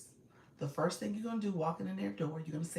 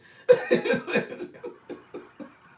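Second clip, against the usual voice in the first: long loud breathy laughter; 12 dB louder than usual. Real, not acted. Cracks up at the joke.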